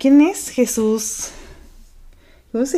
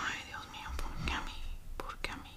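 mock tragic whisper, voiceless; whispered lament; 24 dB quieter than usual. Real, not acted. Vlogger annoyed by a YouTube ad, whispering mock despair. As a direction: moan to the heavens under your breath.

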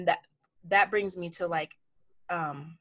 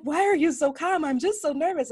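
much higher, whiny panicky voice; mocking nervous moms; 10 semitones higher, 5 dB louder than usual. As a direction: mimic the frantic moms. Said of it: "They're like".

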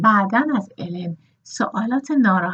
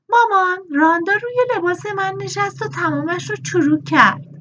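put-on high little girl's complaining call; little Betsy crying out; 10 semitones higher, 4 dB louder than usual. A narrator's reading. Little Betsy complaining to her mother about Randa, childlike whine. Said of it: "بتسی کوچولو فریاد زد".

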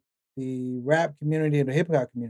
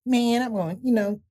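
mock big talk; 6 semitones higher than usual. Guest mocking folks who talk big but never decide, light boast. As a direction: lightly imitate someone all talk. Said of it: "'cause we just talking".

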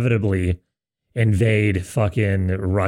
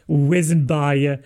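ordinary talk, nothing marked

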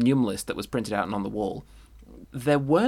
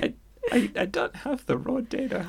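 scared stammer; 12 semitones higher than usual. Acted. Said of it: "Jim looks very scared".

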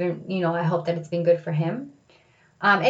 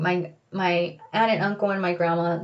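ordinary talk

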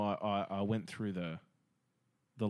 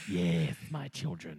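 creepy man; 4 semitones lower than usual. Retold or imitated.

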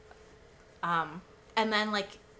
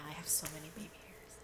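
soft hushed whisper; 3 semitones lower, 16 dB quieter, faster than usual. Real, not acted. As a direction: whisper it close. Whispered aside about hair.

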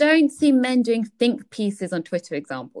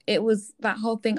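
ordinary talk

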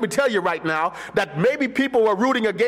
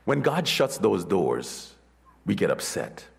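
lower, softer, intimate sermon voice; quiet preaching; 13 semitones lower, 5 dB quieter than usual. Acted. Preacher softly recounting closed doors to the congregation.

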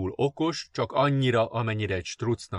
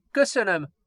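happy shout, high and louder; rejoicing; 9 semitones higher, 4 dB louder than usual. A narrator's reading. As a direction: jubilant little girl thanking. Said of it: "újongott vidáman".

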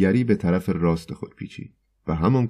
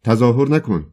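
higher, louder threat; threatening; 5 semitones higher, 5 dB louder than usual. Acted. Poet threatens the professor, higher and louder. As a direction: warn him off, sharp and loud. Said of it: "تهدید کنان گفت".

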